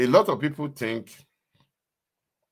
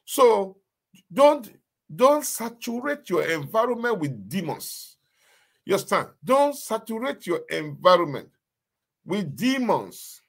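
high, urgent preacher warning; fervent preaching; 8 semitones higher than usual. Acted. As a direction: drive the warning home from the pulpit. Preaching at full force.

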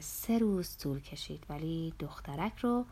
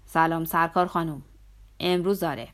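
louder, firm phone greeting; decisive tone; 7 dB louder than usual. A narrator's reading. Narrator phones Rosa Cabarcas to announce today, decisive and louder. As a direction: say it decisively, with more volume. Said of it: "با لحنی قاطع".

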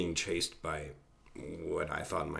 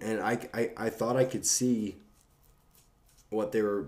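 plain reading voice

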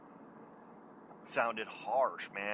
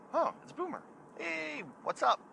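surprised greeting; 14 semitones higher than usual. Retold or imitated. Answering friend's phone call.

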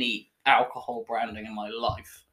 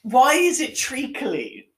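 disgusted whine; 9 semitones higher, 5 dB louder, slower than usual. Real, not acted. Tasting sweet beer.

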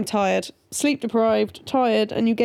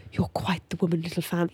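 leaning-in movie quote; 6 semitones lower, 6 dB quieter than usual. Retold or imitated. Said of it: "leaning in and he goes".

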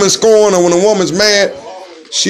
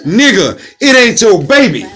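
mock yell; 7 semitones higher than usual. Yelling advice at brother.

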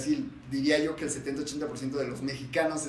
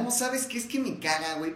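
mock chilango gossip, higher at the start; forced chilango; 8 semitones higher than usual. Friends parodying forced chilango talk, mild mock voice. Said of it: "Como el chilango forzado".

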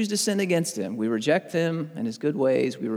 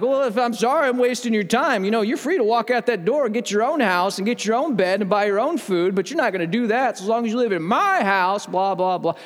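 higher-pitched, worked-up parental tirade; reading riot act; 6 semitones higher, 3 dB louder than usual. Retold or imitated. Father telling off his kid for talking back, exasperated tirade. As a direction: retell an exasperated dad laying down the law. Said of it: "Read this kid the riot act".

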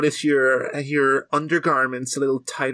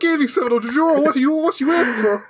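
hammed-up impatient caller; mock angry donor; 12 semitones higher than usual.

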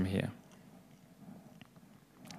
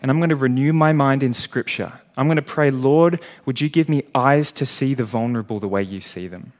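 louder, higher, passionate prayer at full force; fervent prayer; 3 semitones higher, 5 dB louder than usual. Acted. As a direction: cry out to the Lord with fire. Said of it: "You say".

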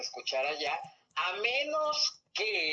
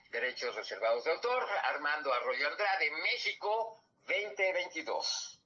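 ordinary delivery

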